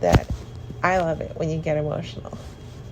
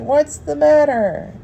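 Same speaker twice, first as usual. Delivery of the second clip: put-on higher, louder voice of a concerned child; imitated little daughter; 9 semitones higher, 6 dB louder, slower than usual. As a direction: imitate a toddler asking her mom. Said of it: "she said".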